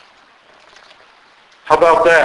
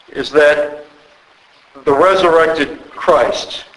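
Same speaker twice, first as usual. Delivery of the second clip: ordinary talk, nothing marked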